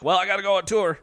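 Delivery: lightly acted musician brushing off family; mock rock dad. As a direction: play the carefree touring dad leaving. Mocking musician leaving family.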